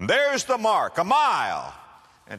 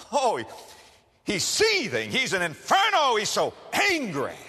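higher, louder rising intensity; heated narration; 7 semitones higher, 3 dB louder than usual. A narrator's reading. Preacher describes the seething farmer, rising intensity. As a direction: preach the rage with fire.

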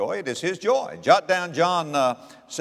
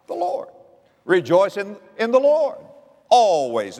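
The Lord sung out much higher, then loud; rising preacher's cry; 6 semitones higher, 6 dB louder than usual. Acted. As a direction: lift it to a triumphant cry. Climax of the sermon.

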